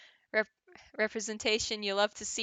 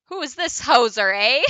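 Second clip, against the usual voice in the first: loud, hammed-up Canadian; Canadian accent; 3 semitones higher, 11 dB louder than usual. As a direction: play an indignant Canadian, loudly. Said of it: "he's Canadian!".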